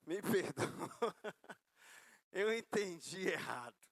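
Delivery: slow apology broken by chuckles; sheepish laughing apology. Retold or imitated. Husband calls back to say sorry, sheepish and laughing. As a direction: laugh at yourself while retelling the apology.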